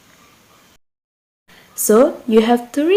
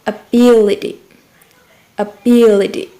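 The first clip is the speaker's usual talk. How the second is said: "Ability" with BIL punched louder; stress on second syllable; 5 dB louder than usual. Shown as a demonstration. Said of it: "also the same as before".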